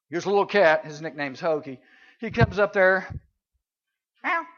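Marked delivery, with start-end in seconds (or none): 4.2-4.5 short rising meow; mock meow. Preacher imitating his cat Hokey, quick little meow. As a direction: mew it like a cat.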